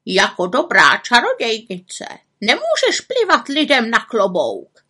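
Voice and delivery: scolding raven with swooping high pitch; reproachful. A narrator's reading. Raven lectures the witch on good behaviour, reproachful. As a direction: lecture in a put-on bird voice. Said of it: "řekl káravě".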